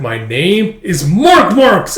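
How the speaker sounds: retold defiant roar; acted angry insistence. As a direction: bellow it out word by word. Retold furious insistence on name.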